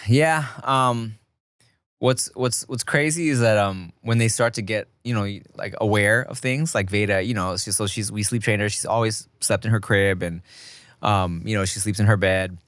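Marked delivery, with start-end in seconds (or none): none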